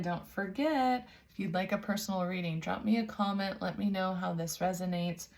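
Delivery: ordinary delivery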